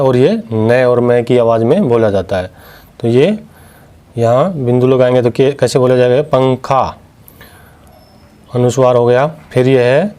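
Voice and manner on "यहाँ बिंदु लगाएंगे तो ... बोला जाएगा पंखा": usual speaking voice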